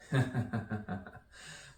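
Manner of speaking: high, rapid run of giggles; laughing at own joke. Real, not acted. Poet laughs after the cheese joke, giggling. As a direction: burst into a chuckle at your own pun.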